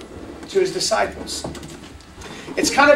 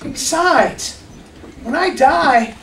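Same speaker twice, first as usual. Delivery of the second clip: softer, gentle calming; soothing father; 3 semitones higher, 4 dB quieter, slower than usual. Acted. A father calming his angry older son, soft and gentle. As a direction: ease him down gently.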